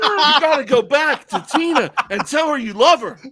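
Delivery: loud, high pep talk; mock impassioned urging. Storyteller urges friend back to his wife, loud mock-earnest urging. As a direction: plead loudly over the laughter.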